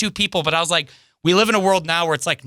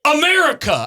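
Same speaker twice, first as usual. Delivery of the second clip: high, loud, falling; high bro voice; 12 semitones higher, 3 dB louder than usual. Retold or imitated. The fan answering the comic, high and loud. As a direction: say it like it's obvious.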